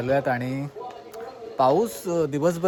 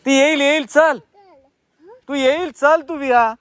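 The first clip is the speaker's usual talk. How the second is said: calling out; 11 semitones higher, 7 dB louder than usual. Real, not acted. Calling the cat to come.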